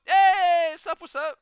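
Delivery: very high, loud cheery hello; excited greeting. Real, not acted. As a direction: sing out a big welcome. Greeting a chat viewer.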